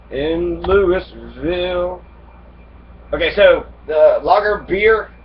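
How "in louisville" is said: Louisville drawn out very slowly; a slow, deliberate Louisville; slow repeat. Shown as a demonstration. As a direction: stretch out Louisville, letting it sink in.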